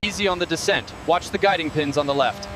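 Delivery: crew chatter over headset radio; helmet radio. Real, not acted. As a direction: sound like a radio transmission.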